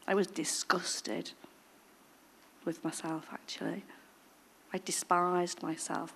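soft, slow, whispered self-disgust; quiet shame; hushed confession. Real, not acted.

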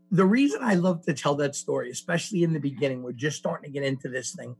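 ordinary talk

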